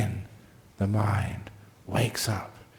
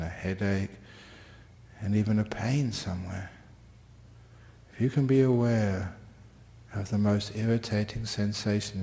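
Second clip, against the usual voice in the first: guided meditation; 3 dB quieter than usual. Real, not acted. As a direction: ease listeners into stillness gently. Leads the class into meditation.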